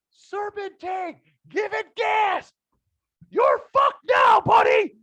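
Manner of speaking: drawn-out high-pitched full-throated shout; yelling. Retold or imitated. Neighbor hollering at a driver on the hill, yelling. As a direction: holler it slowly up the street, very high and loud. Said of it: "I begin to yell".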